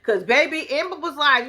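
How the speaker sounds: ordinary delivery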